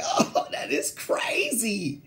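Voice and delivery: slow, laughing amazement; laughing disbelief. Retold or imitated. Host recalling hearing the scandal, slow laughing disbelief. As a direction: draw it out through a laugh. Acting his own amazed reaction.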